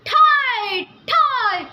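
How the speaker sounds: loud high ‘thai’ gunshot sounds; imitated gunshots. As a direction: bark out the gunfire noises. Gunfire breaks out.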